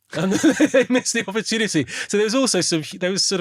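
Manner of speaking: high, loud burst of laughing speech. Real, not acted. Co-host amused by the dry radio remark, laughing. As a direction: talk through a real laugh.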